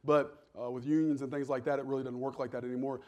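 usual speaking voice

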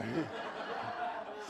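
short amused chuckle; soft laugh. Real, not acted. Laughs at own joke.

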